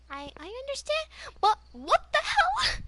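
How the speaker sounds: high, exasperated retold protest; incredulous outrage. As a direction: voice shooting up very high. Acting out her outrage at ex.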